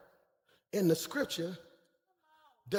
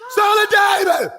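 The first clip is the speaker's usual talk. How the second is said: high, loud preacher's shout of the name; shouted cry; 10 semitones higher, 10 dB louder than usual. Acted. Preacher crying out Son of David to the congregation, full loud shout. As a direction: cry it out like a desperate plea. Crowd cry for blind beggar.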